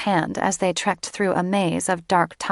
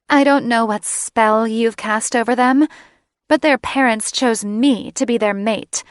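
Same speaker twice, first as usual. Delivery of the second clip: hostile threat; 5 semitones higher, 5 dB louder than usual. Acted. Catherine threatening Sarah.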